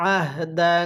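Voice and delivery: drawn-out chanted recitation; slow melodic Quranic chant. Shown as a demonstration. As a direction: recite it like a tajweed teacher. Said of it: "তাহলে কিভাবে পড়ব?".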